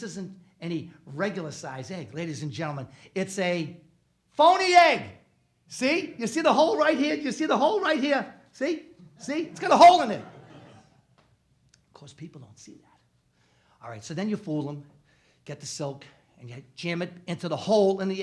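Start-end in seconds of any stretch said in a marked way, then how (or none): 4.3-10.2 high, loud gleeful showing; excited reveal. Real, not acted. Magician reveals the phony egg, high and loud. 10.2-13.8 soft whispered secret; whispered aside. Real, not acted. Magician confides the secret, whispered. Aside about hidden hole.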